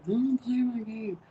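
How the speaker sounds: high, loud mock kid protest; put-on child's tantrum voice; hollering child. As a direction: imitate a kid hollering a complaint. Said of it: "if you keep hollering like that".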